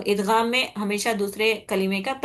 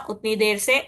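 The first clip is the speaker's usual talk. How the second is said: ordinary delivery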